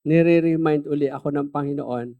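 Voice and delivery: normal talking voice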